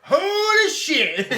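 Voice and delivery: high, loud, slowed, laughing "Holy shit!"; amused exclamation. Real, not acted. A friend cracking up at the accent, loud and high. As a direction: blurt it out, cracking up.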